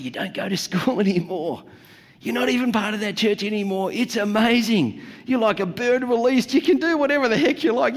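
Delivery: hammed-up liberated rebel; mock euphoric. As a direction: build to a giddy high, lightly hammed. Freebies celebrating release.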